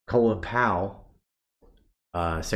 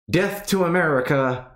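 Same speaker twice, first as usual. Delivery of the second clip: high, mock-menacing slogans; mock note reading; 9 semitones higher, 4 dB louder than usual. Mocking the notes blamed on Al Qaeda, mock slogan voice. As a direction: read the notes out with ironic force. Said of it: "guess what's written on the notes".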